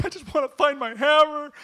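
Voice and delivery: hammed-up sobbing plea; mock crying. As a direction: wail it like a big baby. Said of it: "sitting there crying".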